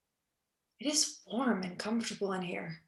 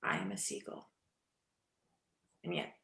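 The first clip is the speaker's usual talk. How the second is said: murmured low; 11 dB quieter, faster than usual. Acted. Nina's broken murmur.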